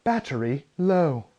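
slow, lightly robotic British lady; a mild imitation of the warning voice. Headphones warning him again, slow mock robot voice. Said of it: "I get another".

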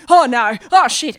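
loud, panicked imitation with laughter behind it; mock afraid. Man caught by his mum before running off, mock panic. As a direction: blurt it like someone caught out. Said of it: "gets really afraid".

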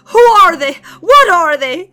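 high, loud frightened questions; panicked plea for an answer; frightened cry; shuddering fear. A narrator's reading.

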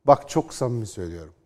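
usual speaking voice

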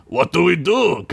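high-pitched panicky question; panicked. A narrator's reading. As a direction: ask it in mock panic. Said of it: "Gunk asked in a panic".

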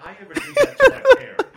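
laughing hard, high and loud. Real, not acted. Father laughing at what his parents would have done. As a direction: burst out laughing on it.

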